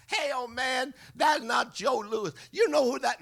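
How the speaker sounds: high, loud scolding shout; indignant correction. Acted. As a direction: jump on him like an outraged minder. Entourage rushes to correct old man.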